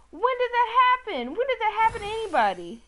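high, loud disbelieving yell; furious yelling question; outraged shout. Real, not acted. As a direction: scream at the game in disbelief. Raging at a game mishap.

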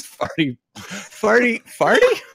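incredulous at the lyric; rising disbelief. Pretend. Rapper stops mid-verse, disbelieving the lyric, rising sharply.

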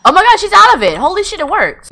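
loud, high burst of amazement; excited disbelief. Real, not acted. Player thrilled the snap-out trick works, excited. Game character snaps out of it.

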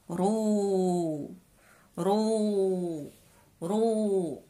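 رو with the long vowel held out. Shown as a demonstration. Teacher showing kids the long vowel in رو. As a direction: hold the oo in رو long. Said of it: "حركة طويلة".

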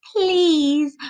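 high, drawn-out "please"; mouthed plea. A narrator's reading. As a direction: beg in one long word. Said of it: "mouthed Zoe".

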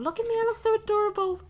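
high, loud delighted squeal. Real, not acted. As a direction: squeal over your own cuteness. Squealing over cute outfit.

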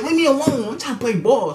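high mock yell; yelling at her to back off. To the pestering girl, a high annoyed yell. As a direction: shoo her off the court.

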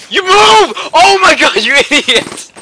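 yelling. Real, not acted. Teammate blocking the way.

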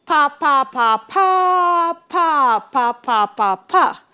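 pa syllables for the whole phrase; ending phrase as pa syllables; rhythm syllables. Shown as a demonstration. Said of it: "So it goes".

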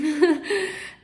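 loud bright laugh; a real giggle at the thought of a dream; happy laugh. Real, not acted. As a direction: laugh with delight. Laughs after naming dream collaborators.

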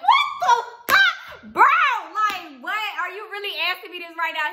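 high, loud disbelieving laugh; shocked laughing outburst at her friend; shocked disbelief. Retold or imitated. Shocked reply to friend's question.

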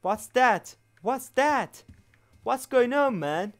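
high, loud real panic; panicked English cries. Real, not acted. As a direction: cry out at something scary. Scared player panics in English. (panikle yüksek sesle)

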